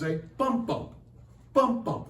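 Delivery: "bump, bump" in DUM-da pairs, the first "bump" of each pair stressed and the second falling; trochaic bump rhythm. Shown as a demonstration. Teacher shows students the trochee, stressing the first beat of each pair. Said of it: "It's called a trochee".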